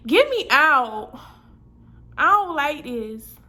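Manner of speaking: loud, drawn-out mock plea to escape; acted panicked plea. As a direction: stretch it out, begging to be let out.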